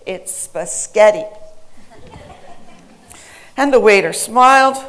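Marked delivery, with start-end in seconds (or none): 0.1-1.2 SPAGHETTI said slowly as spuh-SKET-ee, punched in the middle; slow, louder "spaghetti" with an extra K, spuh-SKET-ee; incensed child's mispronunciation. Retold or imitated. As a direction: imitate a three-year-old firmly correcting with a garbled "spaghetti". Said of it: "was incensed at the pronunciation".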